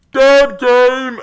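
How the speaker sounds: loud, high mocking yell; exaggerated high-pitched heckle, shouted; mock doomsayers' shout. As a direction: holler it like an over-the-top naysayer. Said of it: "people were saying".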